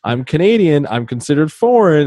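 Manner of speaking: high, rising retold protest; alarmed interjection. Crewman on the bridge stops his shipmates, alarmed interjection. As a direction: cut in urgently, pitched well up.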